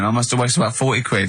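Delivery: ordinary talk, nothing marked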